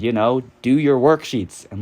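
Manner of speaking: ordinary talk, nothing marked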